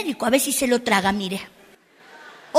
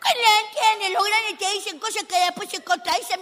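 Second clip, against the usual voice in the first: usual speaking voice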